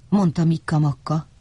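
plain reading voice

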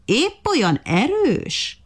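high, slowly drawn-out taunt; sneering monkey, sing-song and slow; mocking. A narrator's reading. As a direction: stretch the question out in scorn. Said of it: "kérdezte gúnyosan a majom".

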